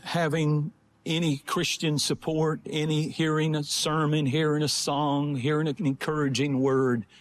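low-pitched, measured testimony with long pauses; sober sermon storytelling. Real, not acted. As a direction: tell the story gravely, pausing between phrases. Tells illustrative story in sermon.

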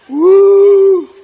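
high, loud joyful woo; excited whoop. Real, not acted. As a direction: rouse the congregation. Crowd-rousing cry.

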